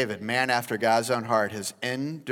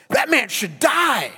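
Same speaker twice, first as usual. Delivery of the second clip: much higher and louder, spitting the words; spat-out outrage; 14 semitones higher, 10 dB louder than usual. Acted. David judging the thief, furious and loud. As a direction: spit it out in fury. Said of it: "He spits out this".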